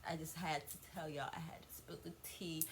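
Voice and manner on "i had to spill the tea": conspiratorial gossip whisper. Real, not acted. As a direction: lean in and whisper the secret. Gossip aside to viewers.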